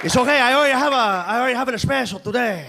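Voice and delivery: the doorman politely declining; Puerto Rican doorman accent. Retold or imitated. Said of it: "Great accent".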